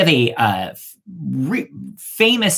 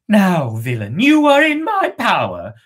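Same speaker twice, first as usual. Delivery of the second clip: rising, louder triumphant cry; 5 semitones higher, 4 dB louder than usual. Acted. Witch maiden to captured prince, triumphant louder cry. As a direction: crow over your captured enemy. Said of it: "cried the witch maiden".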